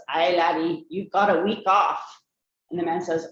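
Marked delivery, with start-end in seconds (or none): 0.1-2.0 a self-admittedly bad Scottish brogue for the doctor; mock Scottish accent. Telling the family's yearly joke, a hammed-up Scottish doctor accent. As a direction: play the joke's doctor in an amateur Scottish accent. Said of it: "I have a terrible accent".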